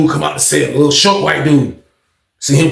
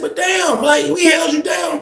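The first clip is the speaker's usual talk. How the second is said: high, softer retold appeal; protesting; 12 semitones higher, 4 dB quieter, slower than usual. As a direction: plead your loyalty in a raised pitch. Pleads with Jake about loyalty.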